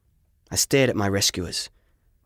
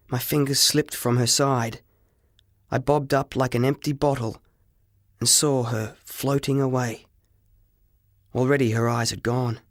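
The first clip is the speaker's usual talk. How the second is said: ordinary delivery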